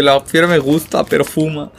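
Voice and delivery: mock fan comment. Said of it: "Fiera, dice".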